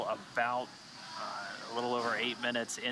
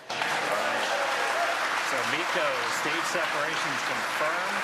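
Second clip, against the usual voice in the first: raised, louder with excitement; excited; 4 semitones higher, 7 dB louder than usual. Real, not acted. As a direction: announce it with a rush of excitement. Stage separation call just confirmed.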